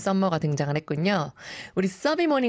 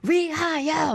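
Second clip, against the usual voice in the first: 1 toast shout; 10 semitones higher, slower than usual. Retold or imitated. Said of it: "막 이러면서".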